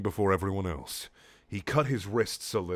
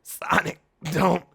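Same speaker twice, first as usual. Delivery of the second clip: higher, feeble plea; weakling voice; 7 semitones higher, 4 dB louder, slower than usual. Acted. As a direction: whimper it like a weakling. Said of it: "like a weakling".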